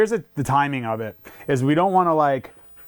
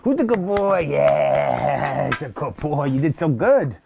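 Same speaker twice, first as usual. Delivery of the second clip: sing-song dog praise; 3 semitones higher, much slower than usual. Real, not acted. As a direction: gush over the dog like he's a puppy. Trainer praising the dog warmly.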